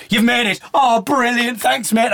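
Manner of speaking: imagining the triumphant hug before doors close, mock-excited; imagined delight. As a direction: play the relief for laughs. Imagined cheer at the train.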